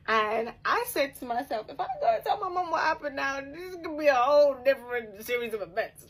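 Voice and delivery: telling herself off with a laugh; excited, laughing. Retold or imitated. Telling the listener her thought, laughing and loud.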